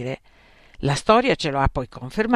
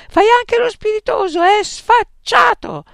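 raging father; 10 dB louder than usual. Acted.

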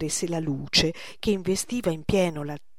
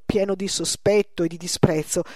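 ordinary delivery, nothing marked

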